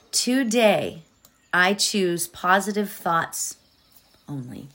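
calm mantra recitation; guided meditation. Acted. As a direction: recite the mantra gently and evenly.

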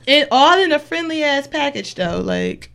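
usual speaking voice

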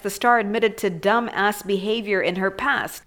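ordinary talk

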